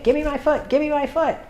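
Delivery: high whiny puppy demand; high mock tantrum voice; puppy pitching a fit. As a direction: whine like a toddler having a tantrum. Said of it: "They're pitching a fit".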